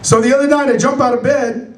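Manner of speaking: ordinary delivery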